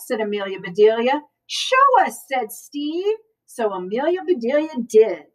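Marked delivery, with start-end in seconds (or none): 1.5-2.3 put-on child's dare. A narrator's reading. Children daring Amelia again.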